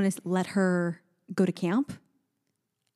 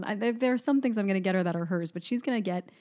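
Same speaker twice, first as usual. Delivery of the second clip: normal talking voice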